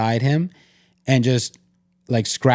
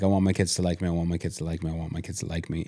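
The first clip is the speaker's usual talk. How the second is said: low repeated murmur fading softer; looping inner thought; 5 semitones lower, 8 dB quieter, much faster than usual. Retold or imitated. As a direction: repeat it under your breath, fading away. Looping private thought, voiced fading.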